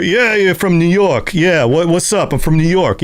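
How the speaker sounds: a hammed-up New York voice; New York accent.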